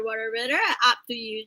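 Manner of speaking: normal talking voice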